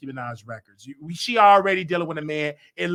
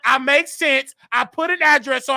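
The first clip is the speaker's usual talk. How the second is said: loud, raised-pitch declaration; refusing to whisper; 5 semitones higher, 6 dB louder than usual. Real, not acted. Host standing up to a caller, loud and insistent. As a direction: push it out high and forceful. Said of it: "not gonna talk with no whisper".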